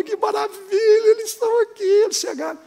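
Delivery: very high-pitched mock rapture; imitating gleeful marvelling. Preacher imitating a girl delighted to see spirits, high gleeful marvelling. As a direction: act out her overjoyed wonder, voice soaring. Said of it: "ela gargalhava, e ela falava".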